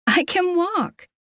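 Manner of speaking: high, lilting amused protest; laughing protest. A narrator's reading. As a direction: protest lightly, voice lifted high and stretched out. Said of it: "She laughed".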